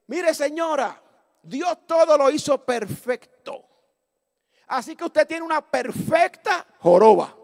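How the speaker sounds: slow, loud, deliberate pronouncement; emphatic retort. Retold or imitated. Preacher answering the hunchbacked lady, emphatic retort. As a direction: draw out each word with weight.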